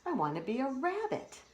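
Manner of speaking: put-on high, childlike froggy voice; a small frog begging to be a rabbit; lightly squeaky kid frog; little frog voice.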